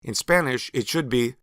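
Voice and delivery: usual speaking voice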